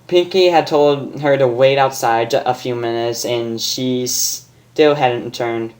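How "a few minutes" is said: ordinary delivery, nothing marked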